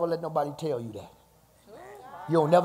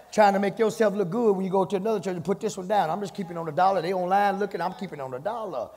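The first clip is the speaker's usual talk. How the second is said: higher preaching build; 4 semitones higher than usual. Acted. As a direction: press on each phrase. Preaching at full force.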